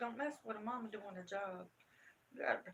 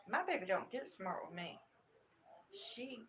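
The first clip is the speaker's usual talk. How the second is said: ordinary talk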